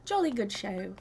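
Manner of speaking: lightly put-on British accent, Blathers-style; a playful hint of a British owl curator. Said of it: "imagined Blathers with a British accent".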